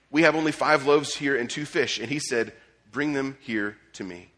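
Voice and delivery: a preacher reading the passage aloud; scripture reading. Acted. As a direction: read the text out like it matters.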